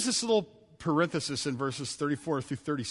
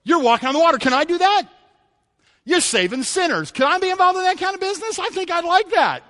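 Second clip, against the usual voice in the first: much higher, louder eager asking; eager Peter; 12 semitones higher, 9 dB louder than usual. Retold or imitated. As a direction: bubble over like eager Peter. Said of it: "guys like Peter that go".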